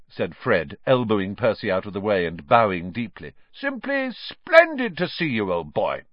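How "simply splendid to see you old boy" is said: very high, hammed-up society greeting; mock-pompous gushing. A narrator's reading. Fred elbowing Percy aside to greet Harry, mock-pompous gushing. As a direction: gush as if at a grand reception.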